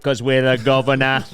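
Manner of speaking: exaggerated British catchphrase delivery; mock British accent. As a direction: put on a broad British accent. Imitating British pie-video guy.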